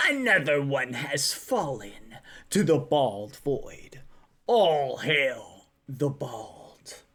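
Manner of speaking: grand, drawn-out cult chant in a put-on voice; mock-solemn proclamation. Reads mock cult proclamation about baldness.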